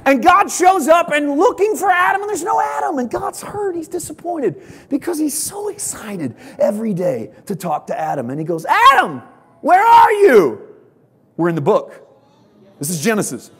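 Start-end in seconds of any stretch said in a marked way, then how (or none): 0.0-6.4 full force preaching. Acted. 8.7-10.6 loud, high call across the garden. Acted. God calling out for hiding Adam.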